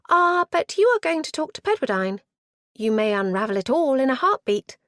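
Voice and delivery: put-on high, bright eager girl voice; eager young niece. A narrator's reading. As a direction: play the keen niece, rushing.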